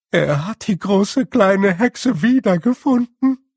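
high, loud, fretful little voice; squeaky wail. Acted. A small blue man wails in fear at the snow. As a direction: wail it like a panicking small creature.

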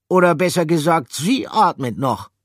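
high, loud correction; character voice. Acted. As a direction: correct him pointedly.

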